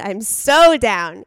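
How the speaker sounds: drawn-out, eager and loud; excited. Real, not acted. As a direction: really sell how into it you are. Excited reaction to coffee blend.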